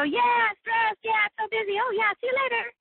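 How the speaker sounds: very high, bright and brushing it off; mock breezy chit-chat. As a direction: chirp it like a hurried hallway greeting. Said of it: "And we go".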